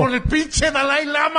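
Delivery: slow, high disgusted outburst. Real, not acted. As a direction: drag it out in disgust.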